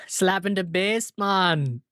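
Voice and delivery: slow, hammed-up Jamaican catchphrase; imitated Jamaican accent. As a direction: echo the bit in a mock Jamaican accent. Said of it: "like, a Jamaican accent for an entire scene".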